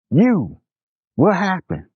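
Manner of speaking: stern question. Retold or imitated. Mother picks one boy to explain.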